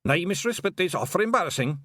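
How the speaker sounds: lightly voiced Scots pixie; a touch of wee Scottish flier; Hamish the Feegle aviator. Hamish answering Tiffany, lightly voiced pixie.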